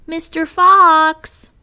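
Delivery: loud, high, long-drawn call; brave shout. A narrator's reading. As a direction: shout it out bravely, stretching the name. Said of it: "with my bravest voice".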